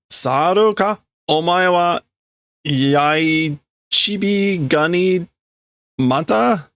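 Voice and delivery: high, slow jeering; mocking insult. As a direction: sneer at him from high up. Workers insult the leader.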